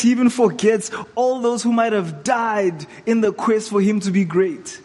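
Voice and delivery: ordinary talk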